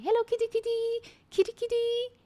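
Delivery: very high sing-song kitty call; calling a stray cat at the door. Retold or imitated. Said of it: "I open the door and I say".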